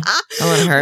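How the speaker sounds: put-on high squeaky baby voice. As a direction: squeak it like a toddler. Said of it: "you only hit that octave when".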